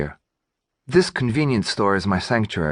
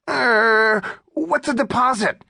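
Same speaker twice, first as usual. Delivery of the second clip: squeaky robber voice; slower than usual. Acted. Robber asks clumsily.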